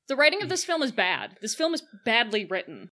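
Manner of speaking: ordinary talk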